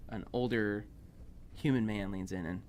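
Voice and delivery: normal talking voice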